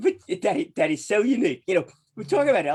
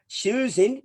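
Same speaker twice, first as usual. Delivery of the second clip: announcer call; 8 semitones higher, 4 dB louder than usual. Acted. Host announces the guest.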